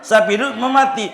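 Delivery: the villager can't believe what he sees; incredulous villager. Retold or imitated. Villager asking the man in high, loud disbelief.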